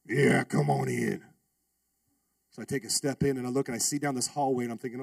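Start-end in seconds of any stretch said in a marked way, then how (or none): 0.1-1.2 low, slow gruff biker voice; biker drawl. Retold or imitated. Biker inviting the student in, low and slow. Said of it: "He talked like that too".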